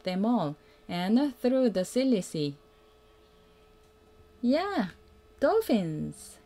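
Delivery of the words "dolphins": ordinary delivery, nothing marked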